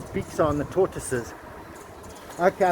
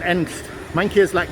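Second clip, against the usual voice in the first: ordinary delivery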